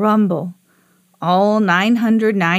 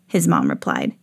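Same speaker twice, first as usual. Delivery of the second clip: ordinary delivery, nothing marked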